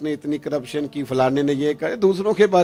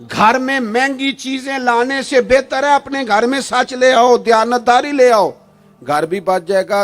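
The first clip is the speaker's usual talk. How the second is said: raised, much higher sermon voice; fiery preaching; 6 semitones higher, 7 dB louder than usual. Acted. Preacher scolding families who lie at weddings, fiery. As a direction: thunder it at the congregation. Preaching against lies at full force.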